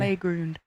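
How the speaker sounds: "Playground" said softly as "play-groond"; Canadian pronunciation. Retold or imitated. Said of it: "Yeah, it's Canadian".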